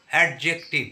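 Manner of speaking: অ্যাডজেক্টিভ in three loud, separate syllables; teacher splitting অ্যাডজেক্টিভ into its syllables. Shown as a demonstration. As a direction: sound out অ্যাড-জেক-টিভ firmly. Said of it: "এগুলো হলো ট্রাইসিলেবল word".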